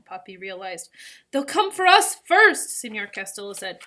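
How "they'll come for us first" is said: high, loud, slow call; raised, drawn-out alarm; urgent. A narrator's reading. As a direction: press the point over the noise. Boat passenger in urgent argument.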